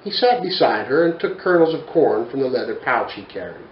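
usual speaking voice

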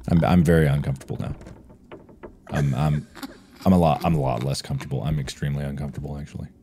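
low, fast, trailing mock nervousness; mock uncomfortable. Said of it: "I'm uncomfortable now".

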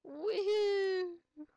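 high, loud whoop; excited whoop. Real, not acted. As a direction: whoop with joy. Flying on bicycle.

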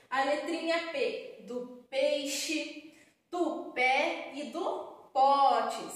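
slow, spelled-out teacher voice; slow and clear. Shown as a demonstration. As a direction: lay out each word slowly. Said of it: "aqui eu fiz a letrinha P".

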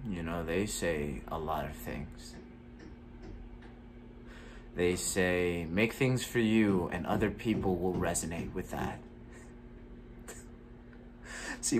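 a jaded actor venting alone; gloomy. Acted. Actor performs a bitter monologue.

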